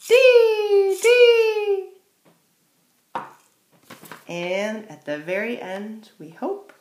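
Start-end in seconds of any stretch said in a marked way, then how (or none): 0.0-1.9 very high, loud bird call; chickadee call. Shown as a demonstration. Teacher singing the chickadee's high loud call. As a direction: sing out like a tiny chickadee. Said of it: "And for the chickadee".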